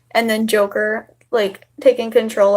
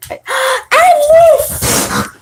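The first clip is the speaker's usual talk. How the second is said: sudden high startled cry; real shock as the pets clash; startled shout; 5 dB louder than usual. Real, not acted. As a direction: gasp then yelp the name.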